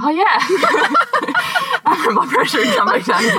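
high, bubbling with laughter; amused at her own forgetfulness, laughing. Real, not acted. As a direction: laugh through the words at herself. Laughing at own goldfish memory.

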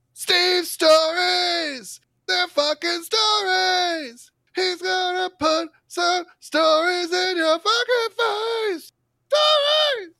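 screaming, very high and drawn-out hype; over-the-top announcer shouting; hyped announcer yell. Acted. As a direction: scream the segment name like a wrestling promo.

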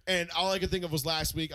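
usual speaking voice

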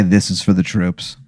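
low, soft, earnest vow. Pretend. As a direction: say it with solemn sincerity. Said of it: "I say earnestly".

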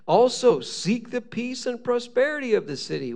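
high, declaimed Bible commands; scripture reading. Acted. Preacher reads God's letter to the exiles, declaiming.

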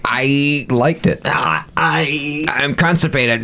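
high, slow, strained; strained joke voice. Pretend. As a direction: squeeze it out like you're straining. Mock strained voice.

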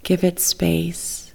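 ordinary delivery

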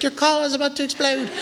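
sweet high British lady voice, laughing; a nice British lady warning, pitched high; British accent. Retold or imitated. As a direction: chirp it like a polite British car voice. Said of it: "a nice lady with a British accent".

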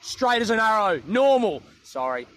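ordinary talk, nothing marked

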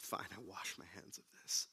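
whispered resignation; weary whisper. Retold or imitated. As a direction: breathe it out, giving up. Pilate gives up, unvoiced.

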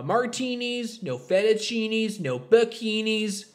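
high, drawn-out mock-stern listing; playfully ban each thing, stretching the words; imagined household rule. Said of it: "what I imagine Andrew's household sounds like".

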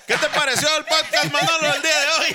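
talking through laughter; laughing. Real, not acted. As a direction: laugh while you speak. Laughing at sleeping friend.

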